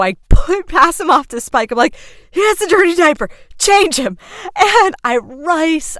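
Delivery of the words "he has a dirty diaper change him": urgent order. Retold or imitated. Orders husband to change diaper.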